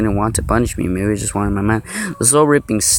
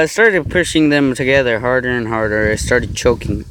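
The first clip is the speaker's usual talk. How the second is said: ordinary talk, nothing marked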